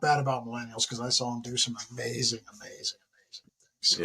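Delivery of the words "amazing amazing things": soft, breathy, almost voiceless; whispered admiration; hushed awe. Real, not acted. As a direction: let the words fade in reverence. Emotional tribute to soldiers.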